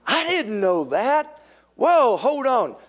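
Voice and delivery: high, loud, eager gasp at news; hammed-up gossip thrill, pitch way up; gossipy surprise. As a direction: squeal with delight at the scandal. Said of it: "Instead of saying".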